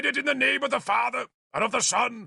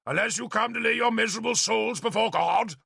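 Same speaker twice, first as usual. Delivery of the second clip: shouting bishop; 10 semitones higher than usual. A narrator's reading. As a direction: thunder the condition at them. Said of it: "he shouted as he drew nearer".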